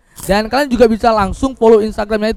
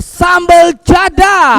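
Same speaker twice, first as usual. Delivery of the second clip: excited; 7 semitones higher, 4 dB louder, much slower than usual. Acted. Presenting hot sauce excitedly.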